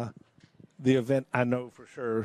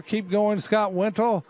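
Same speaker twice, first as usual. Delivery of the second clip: higher, eager cheer; cheering him on; 6 semitones higher, slower than usual. Real, not acted. Commentator cheering Scott Wintle through the course, excited. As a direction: urge him on as he fights to the buoy.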